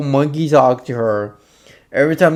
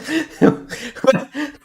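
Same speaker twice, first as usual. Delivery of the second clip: high laugh; 12 semitones higher than usual. Real, not acted. Streamer laughs at clip.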